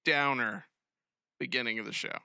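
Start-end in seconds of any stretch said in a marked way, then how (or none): none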